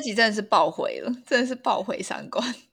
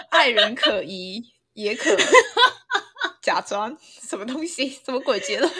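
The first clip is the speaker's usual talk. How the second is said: laughing; 4 semitones higher, 6 dB louder than usual. Real, not acted. Mocking the silly conclusion.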